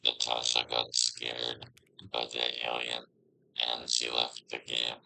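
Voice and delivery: low robotic soldier voice filter; Galactic Soldier voice. Shown as a demonstration. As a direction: speak through a deep sci-fi soldier effect. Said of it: "Let's try Galactic Soldier".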